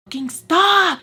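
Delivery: loud, high scream; screaming stop at the top of her lungs. Retold or imitated. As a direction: yell it to make them stop. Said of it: "So I scream really loud".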